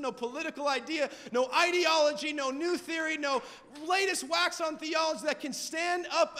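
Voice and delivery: driving, emphatic sermon delivery; punchy pulpit proclamation; impassioned preaching. Real, not acted. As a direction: preach it with rising conviction. Preaches with force.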